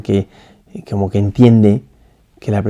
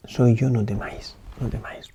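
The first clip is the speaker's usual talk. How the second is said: hushed; 9 dB quieter than usual. Shown as a demonstration. Repite las palabras de Jesús.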